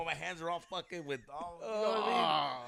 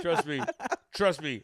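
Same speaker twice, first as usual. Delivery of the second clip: mock macho brag; 3 semitones higher, 9 dB louder than usual. Mocking macho persona continues.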